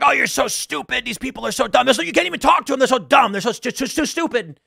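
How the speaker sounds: high, loud, sputtering rant at dumb people; mock a man losing his mind at a poker table; stuttering in outrage over stupid voters; crazy ranting guy. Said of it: "Ranting and muttering to himself like a crazy person".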